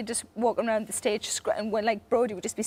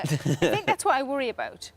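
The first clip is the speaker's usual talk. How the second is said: usual speaking voice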